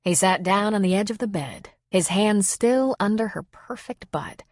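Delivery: ordinary delivery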